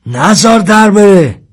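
high, loud, drawn-out yell; loud commanding holler; shouted order. A narrator's reading. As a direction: shout an urgent order across a distance. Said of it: "داد زد".

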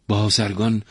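ordinary delivery, nothing marked

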